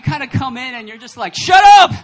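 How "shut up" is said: sudden high, very loud shout; yell. Acted. Walking into a funeral full of mourners, mock furious yell. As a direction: bellow it in mock fury. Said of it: "walk into a funeral and yell".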